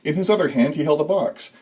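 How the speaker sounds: ordinary delivery, nothing marked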